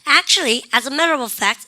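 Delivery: Actually, as a matter of fact run together; linked pronunciation. Shown as a demonstration. Teacher modeling linking for the class. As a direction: link the sounds in "matter of fact". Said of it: "그냥 연음하시면 돼요".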